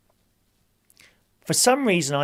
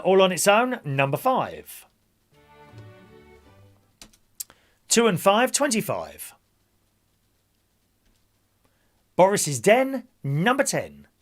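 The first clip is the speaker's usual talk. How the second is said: bingo caller; 4 semitones higher, 5 dB louder than usual. Acted. Calls bingo numbers.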